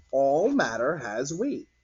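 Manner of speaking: slow, deliberate statement; slow lesson. Shown as a demonstration. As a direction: spell out the key idea. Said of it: "stated slowly as a lesson".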